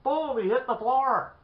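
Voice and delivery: high, loud booming climax; dramatic exclamation. Real, not acted. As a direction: punch out the crash at full excitement. Climax, man faints with a crash.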